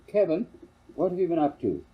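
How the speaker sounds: high, loud call to a son; mother calling out. Acted. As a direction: call out to the boy, alarmed. Mother calls to her muddy son.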